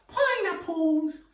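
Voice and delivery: very high, slow and stretched; high silly fruit voice for kids; squeaky high pineapple voice. Shown as a demonstration. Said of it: "So we'll say that".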